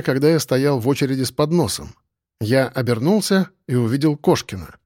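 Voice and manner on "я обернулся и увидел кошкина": usual speaking voice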